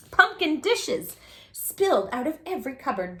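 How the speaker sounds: ordinary delivery, nothing marked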